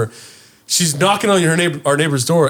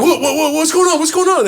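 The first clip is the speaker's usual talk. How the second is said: alarmed; 13 semitones higher than usual. Retold or imitated. Woken in panic, asking mom.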